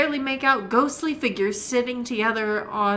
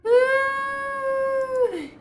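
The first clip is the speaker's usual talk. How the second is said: ghostly moan. Acted. Wraith moans.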